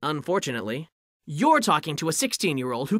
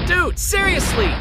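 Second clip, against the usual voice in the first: exasperated; 10 semitones higher, 3 dB louder, slower than usual. Acted. Exasperated hero.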